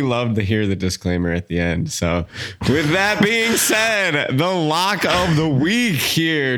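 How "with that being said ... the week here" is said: slow, high, drawn-out announcer build-up; hype announcer. Pretend. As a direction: build it up like a game show host. Announcing the lock of the week.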